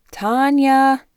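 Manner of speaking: loud, high call down the stairs. A narrator's reading. Mother calls her daughter up from the basement, loud high call. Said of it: "her mother called from the basement".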